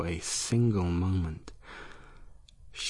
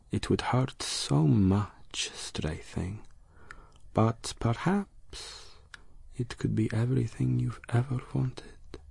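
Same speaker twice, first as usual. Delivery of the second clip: seductive monster whisper; 5 semitones higher than usual. Acted. Monster tempts her softly.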